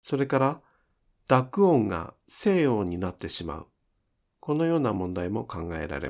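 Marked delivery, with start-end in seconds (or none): none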